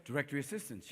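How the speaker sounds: low, hushed recorded-operator voice; quiet automated directory assistance; automated phone operator. Acted. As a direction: speak softly like a phone menu. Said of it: "You don't get to talk to a person".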